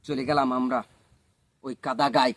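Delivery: ordinary delivery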